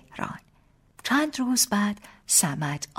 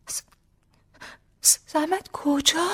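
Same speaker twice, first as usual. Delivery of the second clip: choked up; 9 semitones higher, slower than usual. A narrator's reading. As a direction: voice catching with a lump in the throat, stammering. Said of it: "بغض گلویم رو گرفت".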